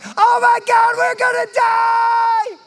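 high, loud panicked shriek; panicked cry of fear. Shown as a demonstration. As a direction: cry out in terror like you're sinking. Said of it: "When you cry out in fear, it's like".

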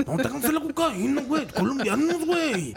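hammed-up norteño Mexican accent, high-pitched; mock a TV narco's northern Mexican accent; high, exaggerated imitation of a norteño accent; Diego Luna. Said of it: "acento de norteño mexicano".

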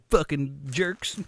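normal talking voice

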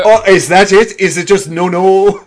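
loud, high Irish accent; rough Irish accent. Shown as a demonstration. Teasing co-host for refusing, high loud Irish accent. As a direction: needle them in a hammed-up Irish brogue. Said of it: "a bit of an Irish accent".

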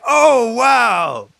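loud, high, stretched-out wow; exaggerated stunned reaction; mock amazement. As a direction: belt out an astonished reaction, hammed up. Recalls his shock at the comic.

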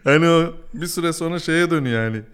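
laughing through the words. Real, not acted. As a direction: talk while laughing. Laughing while explaining.

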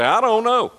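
high, squeaky shrug of a reply; mock clueless listener. Preacher mocks a churchgoer asking who'll own the house, high-pitched. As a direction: mimic a listener dodging the question with a high squeak. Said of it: "You say".

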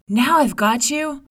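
loud triumphant cry; tigress cry. Acted. The tigress shouts at the mouse, loud and triumphant. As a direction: shout it as you strike. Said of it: "cried the tigress".

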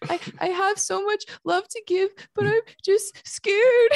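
high, breaking into sobs, slow at the end; crying while speaking. Retold or imitated. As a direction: let the voice crack on scared. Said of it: "like, bawling".

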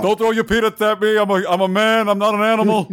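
put-on high, strained protest in a hammy accent; Bernie Sanders. Senator caged in accent zoo, hammed-up indignant impression. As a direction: plead indignantly as a caged exhibit. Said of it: "Put people like Bernie Sanders in the accent zoo".

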